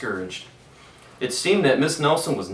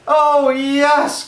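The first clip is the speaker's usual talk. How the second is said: high, loud happy cry; excited kids; 10 dB louder than usual. A narrator's reading. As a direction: cheer it like delighted children. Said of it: "cried the kids".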